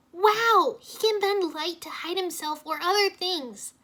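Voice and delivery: high, loud and slow, full of wonder; excited cry. A narrator's reading. Irene marvels at the vanishing fighter, thrilled high cry. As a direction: gush over the invisible man. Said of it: "Irene cried".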